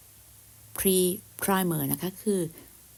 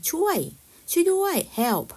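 high, louder pretend call for help; mock cry for help; 9 semitones higher, 5 dB louder, slower than usual. Teacher illustrating the word help, mock cry for help. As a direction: call out as if needing rescue, lightly acted.

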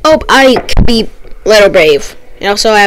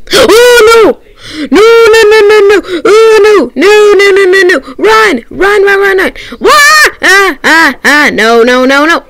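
high, loud panicked screaming; panicking gamer; 10 semitones higher, 4 dB louder than usual. Real, not acted. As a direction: scream no and run in terror. Chased in horror game.

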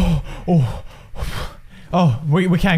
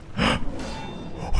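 sharp, voiceless intake of breath; startled gasp; 9 dB quieter than usual. Real, not acted. Gamer startled by a follower in game, startled gasp. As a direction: gasp in sudden alarm.

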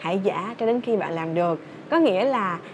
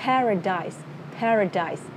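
"paradise" in three beats, DA-da-da; evenly measured "PA-ra-dise"; stress on first syllable; slower than usual. Shown as a demonstration. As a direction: lead "paradise" with PA, falling away. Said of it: "Da, da, da".

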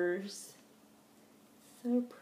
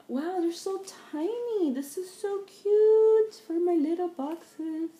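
high, bright excited gush; delighted squeal; 8 semitones higher, 6 dB louder than usual. Real, not acted. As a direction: gush over something tiny. Delighted by tiny dolls.

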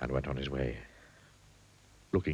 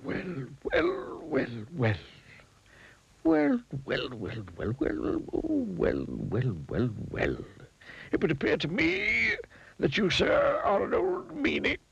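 high wizard, some lines hushed, some shouted; a high wizard playing with his well wells; 9 semitones higher, slower than usual. A narrator's reading. Wizard scolding Mister Mean, high and rising.

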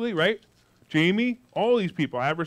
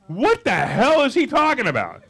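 incredulous; 6 semitones higher, 5 dB louder than usual. Retold or imitated. Voicing audience's confused thought.

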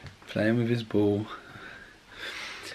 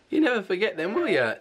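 playful coax; 9 semitones higher than usual. Real, not acted. Dad coaxing his baby son, higher and playful. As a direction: press him playfully.

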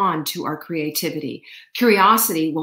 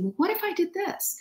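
much higher, softer light question; high curious; 11 semitones higher, 6 dB quieter than usual. Pretend. Showing the curious question we should ask, high and soft. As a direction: float it up lightly. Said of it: "instead of asking".

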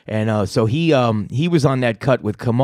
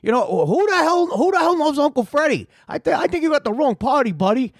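much higher-pitched mock freak-out voice; imitating a guy flipping out; 13 semitones higher than usual. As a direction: quote the exasperated caller, pitched way up. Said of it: "when he's flipping out".

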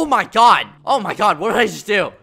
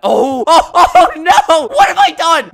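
high, loud panicked wail with a laugh; half-laughing horror at the mess; 10 semitones higher, 14 dB louder, slower than usual. Real, not acted. Builder watches the water spread, wailing half-laughing.

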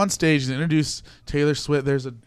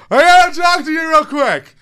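high, loud, rushed plea; frantic fan plea; 12 dB louder than usual. Retold or imitated. Fan calling after the host on the stairs, high and loud. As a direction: beg him to stop, breathless with nerves.

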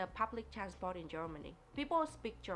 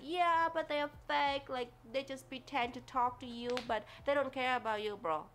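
mock critic; 5 semitones higher, 4 dB louder than usual. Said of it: "people saying things like".

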